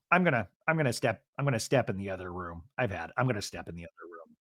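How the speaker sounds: low, soft, hurried mumble; muttered retreat. Retold or imitated. Todd excusing himself from the fight, muttering low and fast. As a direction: fade away under your breath, repeating quickly.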